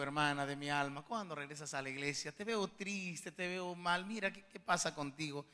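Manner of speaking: low, soft, caring questions; gentle pastoral concern. Retold or imitated. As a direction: retell a pastor quietly reaching out to a sister. Retells gently confronting troubled church sister. (con preocupación tierna, en voz baja)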